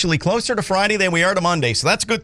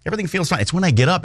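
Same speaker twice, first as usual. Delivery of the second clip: ordinary talk, nothing marked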